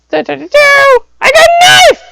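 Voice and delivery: very high, loud squealed boast; acted gleeful shout. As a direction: shout it in a high little-kid pitch. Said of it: "and he goes".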